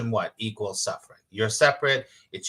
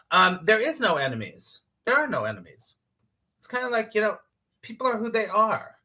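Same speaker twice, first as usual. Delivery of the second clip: usual speaking voice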